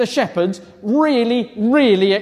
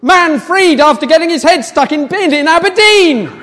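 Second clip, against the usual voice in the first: headline announcement; 5 semitones higher, 7 dB louder than usual. Acted. Reading headline after fanfare.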